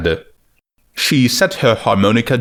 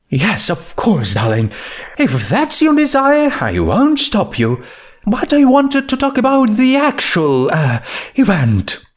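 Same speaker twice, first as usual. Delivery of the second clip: Rarity voice; 11 semitones higher than usual. Acted.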